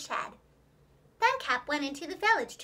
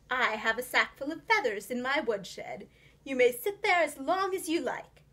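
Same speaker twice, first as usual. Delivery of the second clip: a smooth, courteous villain offering help; silky fox voice, hiding his plan; sly fox gentleman. A narrator's reading. Fox offers Jemima his woodshed, sly and courteous.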